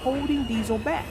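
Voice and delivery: soft, gentle read-aloud voice; storybook narration. A narrator's reading. Narrator reading a train story to young listeners, soft storytelling. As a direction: read it softly like a bedtime story.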